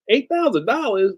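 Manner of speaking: high incredulous mock gasp at the price; mock shocked. Mocking a businessman shocked at a price, incredulous.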